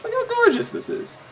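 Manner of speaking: squealing high with wonder; high-pitched awe. Real, not acted. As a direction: gush in a very high voice. Awed high squeal over graphics.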